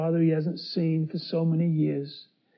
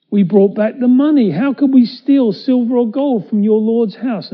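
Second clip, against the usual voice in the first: protesting innocence; 6 semitones higher, 9 dB louder than usual. Acted. Brothers protest their innocence.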